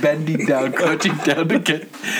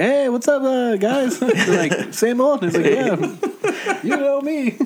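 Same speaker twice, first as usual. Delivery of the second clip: breezy regular; 11 semitones higher than usual. Pretend. Imagined regular bike-thief customer.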